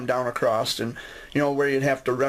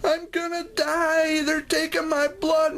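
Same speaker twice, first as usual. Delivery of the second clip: put-on high, slow, whimpering little-boy wail; terrified little kid; slower than usual. Little boy wailing to the nurse, high and slow. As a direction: stretch it out high like a small boy sure he's dying.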